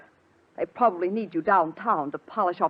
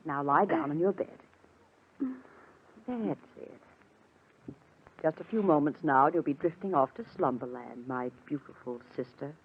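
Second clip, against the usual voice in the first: soft lulling voice, sinking lower at the end; soothing; 3 semitones lower, 4 dB quieter than usual. Acted. Helen puts her drugged sister to bed, soothingly. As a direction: lull her gently off to sleep.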